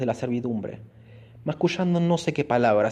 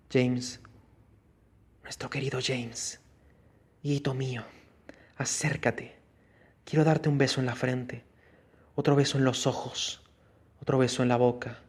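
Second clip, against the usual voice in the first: much softer, hollow voice as if from a jar; hollow tomb voice; 5 dB quieter than usual. A narrator's reading. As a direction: whisper it up from a crypt, tender and dead. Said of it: "una voz como si saliese de un cántaro". (voz hueca de ultratumba)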